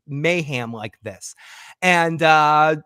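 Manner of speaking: ordinary delivery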